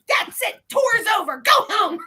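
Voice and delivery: high, loud, fed-up yell; furious shout. Retold or imitated. Guide shouting at the group who abandoned her, furious. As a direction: shut the whole tour down in fury. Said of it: "I'm pissed at this point".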